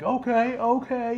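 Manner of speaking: high, accepting okays; mock resigned. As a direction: Kofi accepting his mic cut, high. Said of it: "he was just like".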